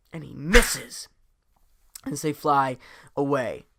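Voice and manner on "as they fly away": plain reading voice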